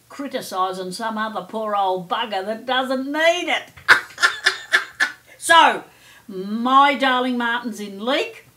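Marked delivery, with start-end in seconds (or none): 3.9-5.1 breathy giggle. Real, not acted. Cheeky laugh at herself.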